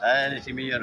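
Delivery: surprised greeting. Real, not acted. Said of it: "repeats greeting".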